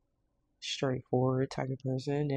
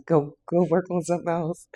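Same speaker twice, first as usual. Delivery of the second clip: loud push; 4 semitones higher, 8 dB louder than usual. Acted. Repeating the blunt order.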